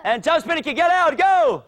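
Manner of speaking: high, loud command to the class; coach's drill shout. Real, not acted. Coach driving kids through jump spinning kicks, shouted command. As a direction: call the kick out hard to urge them on.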